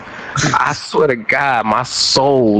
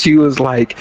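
usual speaking voice

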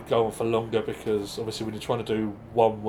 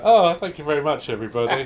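gushing host; 4 semitones higher, 7 dB louder than usual. Retold or imitated. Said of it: "he's like".

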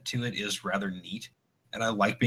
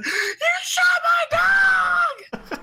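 mock scream; 7 dB louder than usual. Retelling the neighbor screaming at the cops, shrill hammed-up yell. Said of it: "comes out screaming".

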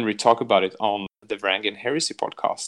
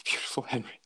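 breathy dreamy whisper of a name; whispered swoon over Henry; 9 dB quieter than usual. Real, not acted. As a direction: sigh the name like a crush.

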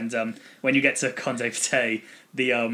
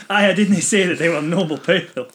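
put-on Scottish accent; 6 semitones higher, 6 dB louder, slower than usual. Twangs Scottish when teased.

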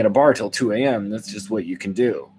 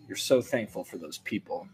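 normal talking voice

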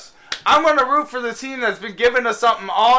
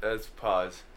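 radio break sign-off; 10 semitones lower, 6 dB quieter than usual. Acted. Host cuts to break.

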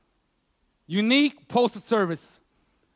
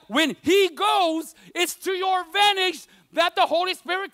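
slower, higher preaching, building force; 4 semitones higher than usual. Acted. Pastor preaching to the congregation, slow and rising.

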